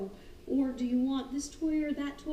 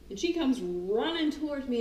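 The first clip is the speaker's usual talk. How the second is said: ordinary talk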